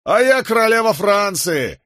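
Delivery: high, jeering shout; mock him loudly from the crowd. A narrator's reading. A wit in the crowd jeers at the condemned man. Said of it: "издевательски крикнул".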